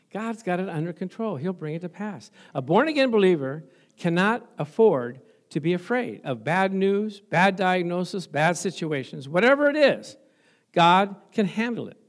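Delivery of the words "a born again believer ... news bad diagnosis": ordinary delivery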